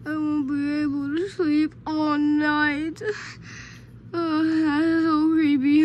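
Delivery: slow, loud wail of dread; drawn-out creeped-out complaint; dismayed moan. Acted. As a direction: groan it out slowly and loudly. Dreading a sleepless night.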